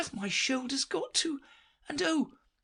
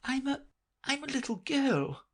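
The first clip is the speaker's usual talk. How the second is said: doubtfully. Acted. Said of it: "rather doubtfully".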